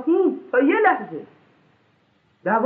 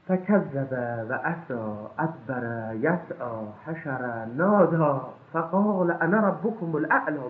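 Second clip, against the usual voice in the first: Quran recitation; 4 semitones lower, much slower than usual. Acted. Very slow intoned Quran verse.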